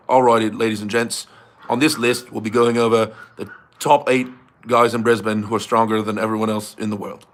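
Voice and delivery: put-on failed Aussie accent slipping into British; low-key mock presenter with British-sounding Australian; [name]. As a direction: imitate an Aussie list-video host, badly. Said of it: "it sounds British".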